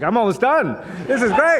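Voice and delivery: high, loud, grinning delight; gleeful grin. Retold or imitated. John telling a friend the work is nearly done, grinning. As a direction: gloat happily through a big grin. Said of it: "shit-eating grin on his face".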